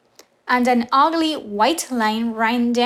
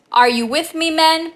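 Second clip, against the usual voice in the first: rallying call; 6 semitones higher, 6 dB louder, faster than usual. A narrator's reading. Ahab rallies his crew.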